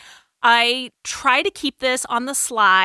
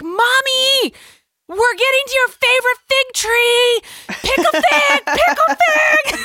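put-on very high, loud child shouting for mom; top-of-voice kid yell; 6 dB louder than usual. Daughter yelling to her mom by the fig tree, top of her voice. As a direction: holler it like a loud, excited little kid. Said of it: "just at the top of her voice".

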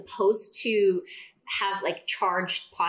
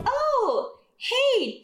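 forced casual, much higher; fake chill; 3 dB louder than usual. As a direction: act breezy but clearly strained. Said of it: "trying to be, like, super chill".

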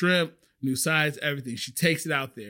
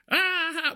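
high-pitched open "ah" of fake laughter; mock big laugh; 14 semitones higher than usual. Shown as a demonstration. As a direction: imitate an outright burst of laughter. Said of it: "Not like".

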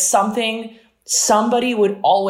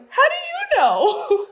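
very high, slow, laughing disbelief; high, drawn-out how do you know; stunned question; slower than usual. Retold or imitated. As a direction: squeak it out in shock. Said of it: "I was like".

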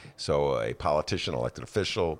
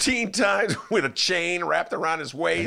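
excited; 12 semitones higher than usual. Pretend. Mocking columnist's boast.

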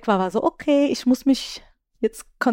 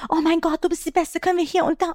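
mock gushing fan, higher pitched; 8 semitones higher than usual. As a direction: imitate an excited fan raving about you. (begeisterter Fan, höher nachgeahmt)

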